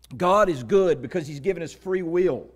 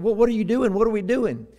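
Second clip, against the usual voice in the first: put-on higher, louder child's questions; puzzled little brother; 6 semitones higher, 4 dB louder than usual. Little brother asking in the closet, puzzled. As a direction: pipe up like a confused small boy.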